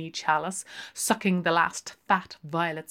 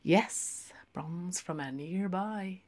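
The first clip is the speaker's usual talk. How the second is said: ordinary delivery, nothing marked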